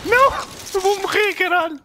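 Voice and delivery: high panicked yell about dying; panicking gamer. Real, not acted. Losing a firefight, about to die.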